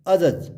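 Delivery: अजज said as "a-judge"; no D at the start of अजज; अजज with only j sounds; silent D. Shown as a demonstration. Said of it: "it's adjudge".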